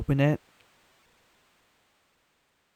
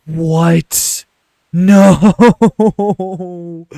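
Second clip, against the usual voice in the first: loud, drawn-out cry of disbelief; anguished shout; 4 semitones higher, 11 dB louder than usual. Real, not acted. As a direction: wail it at full volume. Game crashes near the end.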